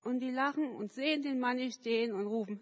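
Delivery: normal talking voice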